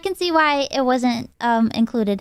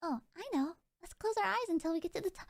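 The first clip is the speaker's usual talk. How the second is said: distant recorded voice; quiet playback from a clip; recording playback; 16 dB quieter, much faster than usual. Real, not acted. Played recording of herself.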